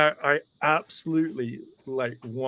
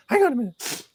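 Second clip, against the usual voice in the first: high-pitched put-on voice; excited fan impression; 11 semitones higher than usual. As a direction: imitate an eager fan, pitched way up. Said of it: "this is literally how he's, uh, how he's speaking".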